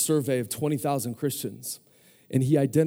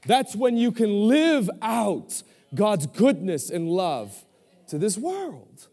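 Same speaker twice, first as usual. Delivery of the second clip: impassioned preaching; 4 semitones higher, 4 dB louder than usual. Acted. Preacher urging the congregation to receive their true story, impassioned. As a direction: preach with building force, key words stretched out.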